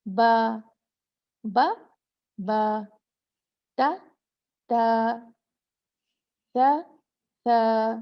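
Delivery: बा and ता each drawn out over two counted beats. Shown as a demonstration. Teacher counting the two-beat length for students, drawn out. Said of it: "इसको कहते हैं दो हरकत".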